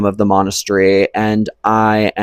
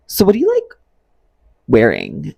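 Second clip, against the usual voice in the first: mimicking her date; 5 semitones higher than usual. Mimicking the date's question.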